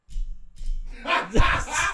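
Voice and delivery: high burst of laughter; big shrieking laugh; loud laugh. Real, not acted. Laughing at the story.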